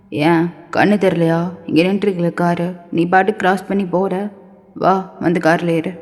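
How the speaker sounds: low-voiced scolding man.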